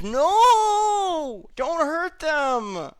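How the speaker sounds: high, drawn-out desperate scream. Acted. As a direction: scream in panic to protect them. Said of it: "Werner screams".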